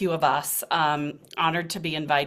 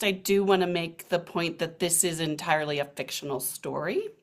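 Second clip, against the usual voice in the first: usual speaking voice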